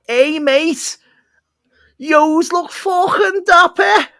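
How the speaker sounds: high, loud, slow mock Liverpool shout; Scouse impression. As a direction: bellow it like a Scouse lad in the street. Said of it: "Do you think that was a good impression".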